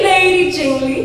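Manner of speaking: amused English echo; laughing repeat. Acted. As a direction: let the second Lady Jingly fall back, chuckling. Second call in verse.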